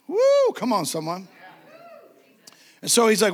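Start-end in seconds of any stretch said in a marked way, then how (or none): 0.0-1.3 excited woo. Real, not acted. Preacher whoops at the congregation, excited. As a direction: whoop to fire up the room.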